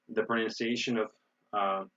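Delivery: normal talking voice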